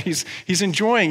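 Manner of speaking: usual speaking voice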